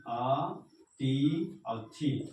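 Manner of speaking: ordinary talk, nothing marked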